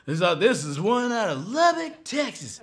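high Texas stage patter; imitated Texas twang. [name] catering to country crowd.

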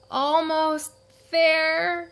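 high, slow strained voice; stretching up on tiptoe, strained; effortful high voice; straining reach. A narrator's reading. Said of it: "she said".

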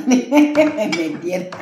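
slow, laughing through the words. Real, not acted. Telling the interviewer how she got in, laughing. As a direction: laugh as you admit they let you in. Laughing recalling getting let in.

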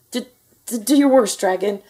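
stuttering, high-pitched dare; stammer, then loudly squeak the challenge; nervous knight's bluster; squeaky knight. A narrator's reading. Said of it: "squeaked a thin".

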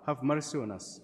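ordinary delivery

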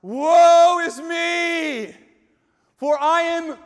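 high, stretched wail of woe; acted despairing cry, at full volume; crying out loud. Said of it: "says out loud".

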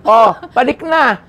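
high, loud, slow call to the crew. Retold or imitated. Director ordering the crew to move on, loud. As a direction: call everyone back up.

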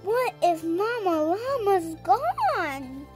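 ordinary delivery, nothing marked

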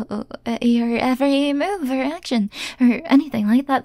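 flustered stammer full of uhs and false starts; nervous stutter. Acted. Caught stalker insists on acting normal, nervous stutter. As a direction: stumble through a feeble cover-up. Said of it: "uh, stuttering?".

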